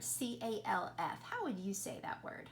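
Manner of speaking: ordinary talk, nothing marked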